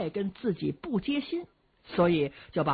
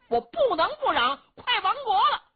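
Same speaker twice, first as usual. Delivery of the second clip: higher and louder, a furious outburst; shouting angrily; 6 dB louder than usual. Acted. Ding shouts at Luo Cheng that their kingdom is falling, furious yell. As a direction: bellow it like a frantic sworn brother. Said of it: "您别嚷好不好？".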